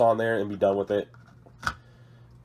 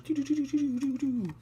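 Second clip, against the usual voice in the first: vocal fanfare; 5 dB quieter than usual. Pretend. Sings a mock tank fanfare.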